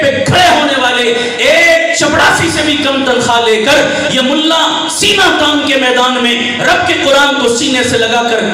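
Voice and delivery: loud, high, emphatic sermon delivery; preaching at full force. Real, not acted. Preacher defending the underpaid mullah, full-force preaching.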